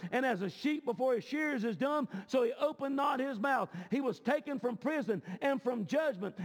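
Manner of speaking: ringing, rhythmic declamation of Bible verses; preached scripture reading. Real, not acted. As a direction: proclaim each verse to the congregation.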